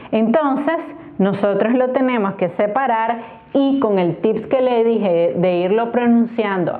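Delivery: usual speaking voice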